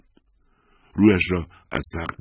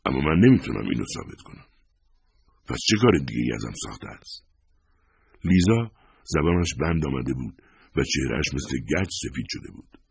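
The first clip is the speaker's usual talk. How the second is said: ordinary delivery, nothing marked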